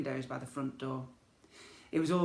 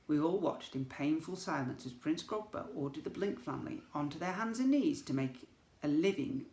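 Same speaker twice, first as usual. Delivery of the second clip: ordinary delivery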